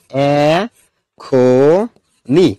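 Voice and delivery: এখনি drawn out very slowly; syllable by syllable. Shown as a demonstration. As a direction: stretch এখনি into separate, held syllables. Teacher spells out the word.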